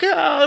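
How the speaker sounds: high mock scream of a frightened baby; imitated baby scream. Dad imitating his scared baby son screaming, high mock scream. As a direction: squeal it out high like the scared toddler. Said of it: "and Chris is screaming".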